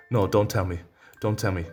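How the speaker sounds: ordinary delivery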